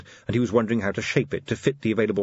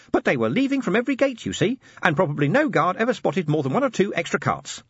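high, chirpy little assistant; squeaky helpful gadget voice; tiny imp voice; 10 semitones higher than usual. Acted. Imp explains.

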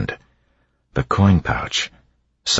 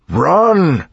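huge high shout, much louder; 5 dB louder than usual. A narrator's reading. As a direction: bellow it to carry across the hall. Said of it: "The word echoed in the domed chamber".

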